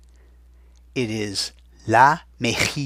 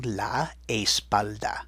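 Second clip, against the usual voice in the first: slow dictation; 3 dB quieter, slower than usual. Shown as a demonstration. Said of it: "I'm pausing so you can write it down".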